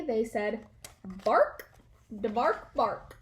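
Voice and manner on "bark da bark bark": playful barky dog voice; dog talk. A narrator's reading. Dog talks to his people, barking. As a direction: bark it out like a happy dog. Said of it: "said Stanley".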